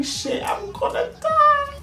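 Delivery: very high, drawn-out panicked wail; high, stretched whine of panic; panicking. Retold or imitated. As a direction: squeal the thought out in mock despair. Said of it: "I am panicking".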